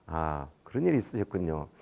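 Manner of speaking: low, soft acknowledgement; subdued sympathetic reply. Retold or imitated. Official placating an upset father, low and subdued. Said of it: "이제 이렇게 말했어".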